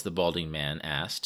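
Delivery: ordinary delivery, nothing marked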